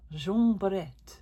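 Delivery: JEAN BARRETTE said slowly in a French accent. Shown as a demonstration. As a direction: give the name an amateur French pronunciation. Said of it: "a bit more of a French accent".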